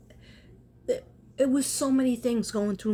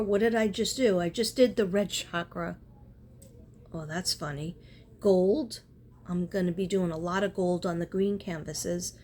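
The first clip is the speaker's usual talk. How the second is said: ordinary talk, nothing marked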